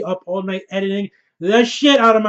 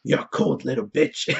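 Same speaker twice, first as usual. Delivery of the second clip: lower, gruff put-on growl of the Hound; 6 semitones lower, 5 dB quieter than usual. The Hound to Arya, a lowered mock growl. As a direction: drop low and rough like a hardened killer. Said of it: "the Hound when he's like".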